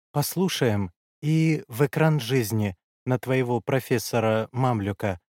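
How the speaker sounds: ordinary delivery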